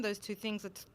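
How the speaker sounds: usual speaking voice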